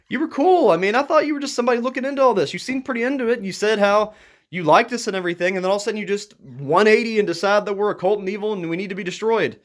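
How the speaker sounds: worked-up outburst aimed straight at them. Real, not acted. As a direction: vent at the person, voice climbing high. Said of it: "I'm getting all worked up".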